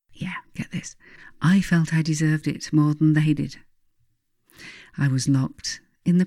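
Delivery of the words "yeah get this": hushed conspiratorial whisper; breathy confiding whisper; whispered aside. Real, not acted. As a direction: lean in and whisper it like a secret.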